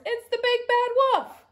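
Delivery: very high, loud cry of alarm. A narrator's reading. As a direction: raise the alarm as a panicked pig. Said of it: "cried Horace".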